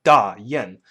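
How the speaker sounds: "Da Yan" with falling tones; Mandarin tones. Shown as a demonstration. Teacher gives Chinese pronunciation of brand Dayan. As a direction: drop the pitch on "Da Yan". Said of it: "it's pronounced".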